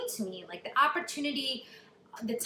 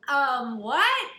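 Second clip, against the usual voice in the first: shocked; 2 semitones higher, 7 dB louder than usual. Retold or imitated. Kelly hearing the news from her agents, shocked. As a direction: stammer in disbelief. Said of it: "I think she's shocked".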